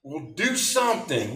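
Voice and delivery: high, urgent demand; hammed-up nagging order; Tony impression. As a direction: put on the pushy roommate's high voice. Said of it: "do my best Tony imitation impersonation".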